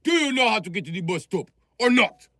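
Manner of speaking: high-pitched, over-emphatic demand; mock agitated character. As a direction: punch the words like you're losing patience. Acts out shouting emphatic character.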